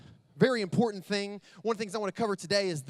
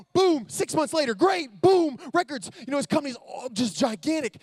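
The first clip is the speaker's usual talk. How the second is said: louder, higher, driving hype; pumped-up storytelling; 5 semitones higher, 5 dB louder than usual. A narrator's reading. Speaker hyping audience with the businessman's success, energetic and loud. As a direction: pump the crowd up with each boom.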